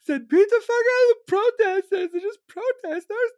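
very high mock-hysterical wail; high caricature of a scandalized liberal; whiny outraged reader. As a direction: squeal in exaggerated disbelief. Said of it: "These ignorant Dem motherfuckers are probably reading this, be like".

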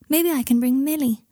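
high-pitched character voice for Luna; voiced Luna. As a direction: lift the voice high for her line. Luna voiced in narrated story.

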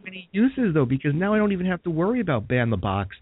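high excited pitch. Real, not acted. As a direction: rush in, thrilled by the idea. Excited about psychic hire.

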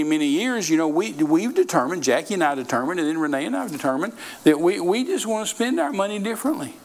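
ordinary talk

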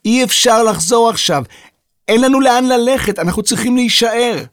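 polite shout; shouting politely. A narrator's reading. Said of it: "וחזר בצעקה".

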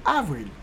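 avril said as ahv-REEL; French pronunciation. Shown as a demonstration. Teacher giving learners April in French, avril as ahv-reel. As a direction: model avril the French way, same as Creole.

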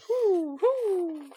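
high owl hoo, hoo; owl hoots. Acted. GM makes the owl's hooting noise. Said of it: "A hooting noise".

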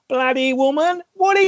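high, broad Australian ocker voice; ocker Australian accent. Retold or imitated. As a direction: bark it like a rough Aussie bloke. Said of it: "ocker kind of, like, Australian accent".

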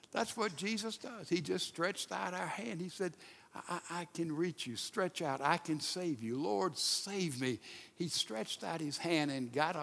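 quiet, tender telling of rescue; hushed. Acted. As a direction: drop right down, gently.

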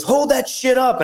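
high, slow hammed-up yell; coach yelling. Coach handing over the Marine flag, mock yell. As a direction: bellow it over the crowd. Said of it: "he goes".

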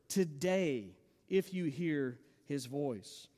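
"today" given weight, then slow, hushed phrase; weighty TODAY. Real, not acted. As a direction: lean on "today", then slow right down and drop away. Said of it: "Feel the weight of this word".

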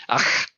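"ach" with a rasped back-of-throat fricative; guttural Gaelic sound. Shown as a demonstration. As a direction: demonstrate the harsh Scottish "ch" in "ach". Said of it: "you'll hear lots of".

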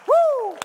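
high, loud shouted woo; joyful whoop. Real, not acted. Preacher whooping mid-sermon about worship, high and loud. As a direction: cry out in praise.

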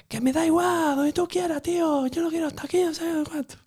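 mucho más agudo, imitando a un chaval harto; hijo adolescente pasota. Retold or imitated. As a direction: haz de adolescente que pasa de todo. (imitando al hijo, agudo y desganado)

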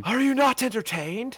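booming Gladiator arena roar; Russell Crowe. Retold or imitated. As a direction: shout it like a Roman general to the crowd. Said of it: "thank you, Russell Crowe".

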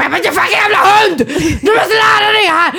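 high, frantic, laughing rant at a dog; mock furious yell at dog. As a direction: scold the dog, losing it. Yelling at scared dog.

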